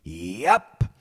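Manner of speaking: high, loud, drawn-out glee; triumphant, gleeful boast. Retold or imitated. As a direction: crow it with relish, stretching the words. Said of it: "his eyes were just glowing with glee".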